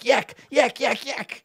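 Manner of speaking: high squeaky goblin yammering; shrill creature babble; goblin chatter. Acted. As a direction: chatter like excited little goblins. Goblins chattering around the lord.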